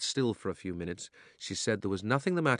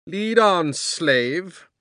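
loud, haughty command; commanding witch; 9 semitones higher, 10 dB louder, much slower than usual. A narrator's reading. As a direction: order him like a queen.

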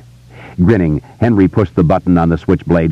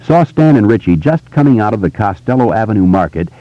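ordinary delivery

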